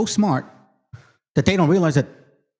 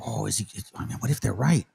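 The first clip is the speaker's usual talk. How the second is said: quiet doubting inner voice; 5 semitones lower, 7 dB quieter than usual. Retold or imitated.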